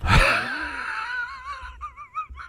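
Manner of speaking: sudden high-pitched burst of laughter. Real, not acted. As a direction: cackle high up. Laughs at own joke.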